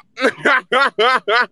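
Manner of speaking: high, loud burst of laughter; laughing. Real, not acted. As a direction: crack up at your own joke. Cracks up at own chop joke.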